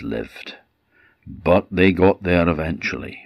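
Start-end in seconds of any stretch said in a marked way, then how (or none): none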